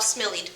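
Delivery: low, soft "smillied", said SMILL-eed; "smillied" with a short i, then ee; wrong reading with short I. Shown as a demonstration. Teacher showing the I by itself, low "smillied" as SMILL-eed. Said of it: "The I would now be by itself".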